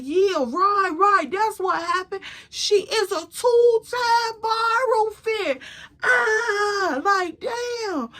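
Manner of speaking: high, exaggerated teasing voice; hammed-up high-pitched ridicule; mocking disbelief. Acted man mocking the borrowed outfit.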